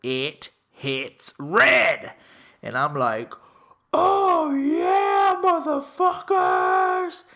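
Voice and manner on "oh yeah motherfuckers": high, loud, drawn-out victory yell; triumphant stretched-out shriek; screaming celebration. Retold or imitated. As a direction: scream it like you just hit big. Celebrating a roulette win.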